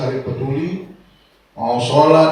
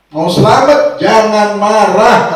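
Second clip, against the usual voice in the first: emphatic command; 6 semitones higher, 9 dB louder, slower than usual. Acted. Quoting the Prophet's command emphatically.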